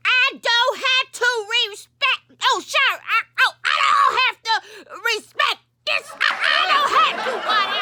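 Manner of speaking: very high, worked-up wail; so mad. Acted. Refusing Deacon Waddell about respecting mama, furious and tearful. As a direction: sputter and stumble in outrage. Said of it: "I got so mad and so mad".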